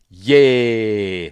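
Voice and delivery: loud playful yay; mock cheer. Watching a gory scene, cheering a loud playful yay. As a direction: cheer like a delighted kid.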